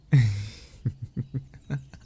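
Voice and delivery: a rising, delighted laugh; bright laugh. Real, not acted. Laughing at the friend's teasing, bright laugh. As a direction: laugh it off, caught out.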